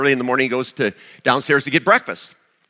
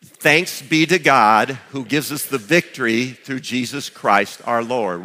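slow, measured unison reading; slow recitation; slower than usual. Shown as a demonstration. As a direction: recite each word slowly with the room. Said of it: "let's say the whole verse together".

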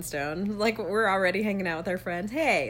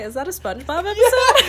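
higher and louder, laughing through it; 11 semitones higher, 9 dB louder than usual. Real, not acted. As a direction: ask it while cracking up. Laughing question.

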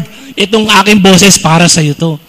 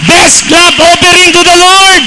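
urging louder; 8 semitones higher than usual. Real, not acted. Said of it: "Nako, mahina".

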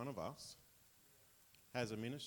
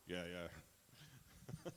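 imitating dad; 6 semitones lower, 7 dB quieter than usual. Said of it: "He's like".

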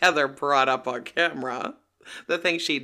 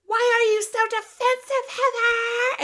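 very high, loud mimicked screech; hammed-up high-pitched yell; mock screaming; 4 dB louder than usual. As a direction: screech it as an over-the-top impression. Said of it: "Whitney yells at Heather".

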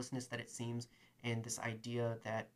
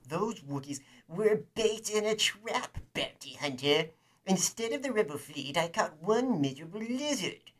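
high, loud, sneering official; pompous governor; 5 semitones higher, 6 dB louder than usual. Acted. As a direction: gloat over the caught lizard. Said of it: "governor reply".

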